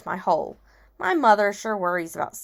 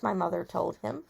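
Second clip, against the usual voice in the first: plain reading voice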